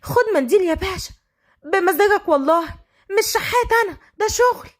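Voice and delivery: high-pitched street seller's pitch; hoarse street vendor. Acted. Tissue girl running after cars, hoarse high pitch. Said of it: "وتقول بصوت مبحوح".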